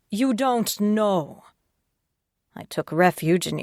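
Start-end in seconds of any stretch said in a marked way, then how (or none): 0.1-1.4 slow, higher, incredulous echo; flat disbelief. A narrator's reading. Detective echoing McNevin's answer in disbelief. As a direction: throw his words back at him, slowly.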